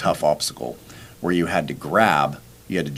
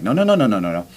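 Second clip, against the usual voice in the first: insistent refusal; 3 semitones higher, 3 dB louder, faster than usual. Retold or imitated. Refusing to give up.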